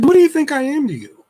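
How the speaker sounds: high, sing-song parent voice; talking to a child. Retold or imitated. Dad asking young daughter, child-directed voice. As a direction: ask it high, as to a small child. Dad gently questioning six-year-old.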